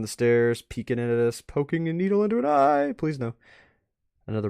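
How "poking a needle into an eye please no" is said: high, loud disgusted wince; squeamish. Real, not acted. Seeing a needle in an eye, squeamish cry. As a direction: recoil from the image.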